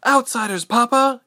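high, loud alarmed daughter. A narrator's reading. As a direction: cry the warning to your father. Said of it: "Ilse cried".

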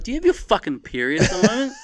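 snappy comeback. Retold or imitated. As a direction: fire it back at her quickly. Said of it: "I'm like".

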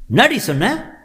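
very high, loud indignant snap; outraged challenge. Acted. First wife challenging the bride, high and loud. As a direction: rise up furious at the insult.

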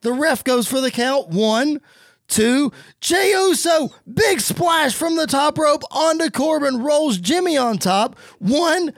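rising excited play-by-play call; wrestling commentator. Acted. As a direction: call the finish like a ringside announcer.

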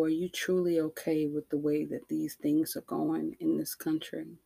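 ordinary talk

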